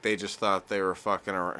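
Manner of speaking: ordinary talk, nothing marked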